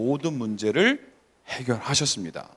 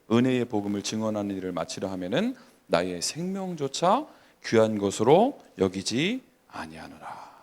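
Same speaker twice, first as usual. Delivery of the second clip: lower, slow-opening recitation of a verse; formal Bible reading in a lowered voice; scripture reading; 3 semitones lower than usual. Acted. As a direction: read it out solemnly, phrase by phrase. Reads scripture aloud.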